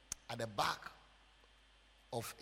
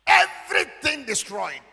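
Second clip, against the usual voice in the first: high, slow, very loud cry; drawn-out shout of total ruin; anguished preacher's shout; 9 semitones higher, 13 dB louder, slower than usual. Retold or imitated. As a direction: shout both words out to the whole room. Repeats the ruin at full voice.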